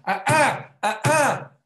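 loud high scolding cry at the table; father scolding the table so the crying child calms, mock loud ah ah. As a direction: ham up a dad scolding furniture.

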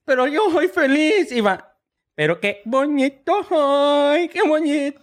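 put-on high, slow, self-admiring child; high kid voice. Nasal boy admires himself walking down the street, high and slow. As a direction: croon it like a boy delighted with himself. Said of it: "era un niño gangoso".

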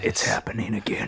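hushed, breathy warning to the crew; a low unvoiced captain's whisper; ominous whisper. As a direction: whisper it like doom is coming.

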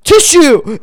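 high, loud mock wail; imitating hysterical friend. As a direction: wail in horror like a panicking son. Hysterical friend retold on phone to mom.

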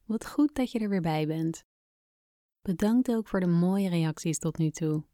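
normal talking voice

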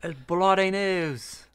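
slow, mock British "Bloody News"; British accent. Shown as a demonstration. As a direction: drawl "Bloody" the British way, like Harry Potter. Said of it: "Say it with a British accent".

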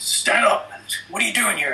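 high, commanding cop voice; imitated cop order. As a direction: act out an officer giving orders. Cop orders him to stand.

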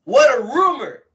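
loud, high exclamation of WHAT A RUMOR. Real, not acted. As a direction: belt it out over the booing. Repeats it over the boo.

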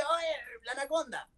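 high, hushed squeal of excitement; high-pitched, contained fan thrill; excited realization. Retold or imitated. Re-enacts hearing Anaconda come on.

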